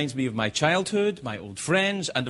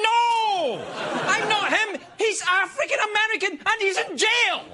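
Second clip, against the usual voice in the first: very high hammed-up yell; exasperated denial. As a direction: protest at the top of your range. Protests at being mistaken for murderer.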